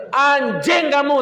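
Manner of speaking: high, loud and slow curse; cursing him. Retold or imitated. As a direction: spit the insult out in disgust. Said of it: "tak gitu kan". (memaki napi yang masuk penjara lagi, lebih tinggi dan keras)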